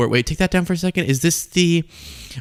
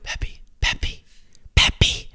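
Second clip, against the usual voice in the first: urgent whisper of a name; 4 dB quieter, much slower than usual. Retold or imitated. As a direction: hiss the name to prompt him. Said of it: "Susie Wiles being like".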